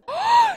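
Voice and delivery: very high, loud gasp; shocked gasp. Real, not acted. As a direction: gasp sharply in alarm. Reacts to near killing.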